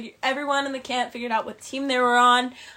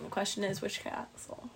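mysterious whisper; 2 semitones lower, 13 dB quieter than usual. Acted. Teasing castle mystery.

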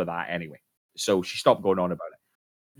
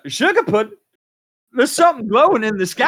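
higher, louder squeaky wife voice; retake of the wife voice; 14 semitones higher, 9 dB louder than usual. Shown as a demonstration. Wife calls husband about glowing sky, loud squeaky alarm. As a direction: give it full alarmed housewife energy. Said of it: "Let me try that again".

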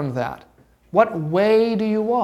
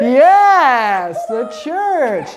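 loud, high, drawn-out cheer of approval; jubilant yes; 6 semitones higher, 7 dB louder, much slower than usual. Real, not acted. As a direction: celebrate a student's right answer, loudly. Teacher celebrates the right answer.

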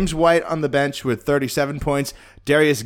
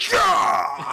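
high shouted cheer; retold shout. Celebrating the win downstairs, a high shout. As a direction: yell it in triumph. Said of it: "I was just downstairs going".

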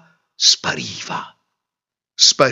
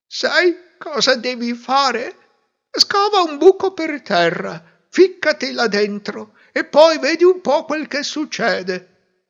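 put-on older hermit; 14 semitones higher, 4 dB louder than usual. A narrator's reading. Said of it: "che le disse".

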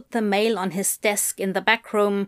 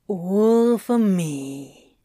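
possessive demon voice; much slower than usual. A narrator's reading. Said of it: "in a possessive manner".

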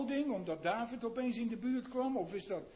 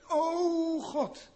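fervent cry; 7 semitones higher, 6 dB louder than usual. Acted. Asaf cries out to God.

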